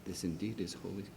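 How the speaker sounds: tender. Real, not acted. Tenderly showing the child the crowd.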